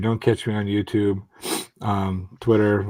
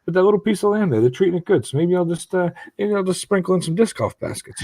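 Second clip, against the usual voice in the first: higher playful God voice; God musing cheerily, pitched up; lightly voiced generous God; voicing God; 10 semitones higher than usual. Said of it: "He's like".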